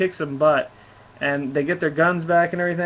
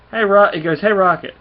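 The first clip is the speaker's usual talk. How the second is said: calling out; 5 semitones higher, 6 dB louder than usual. Acted. Star-Lord calling out to Rocket.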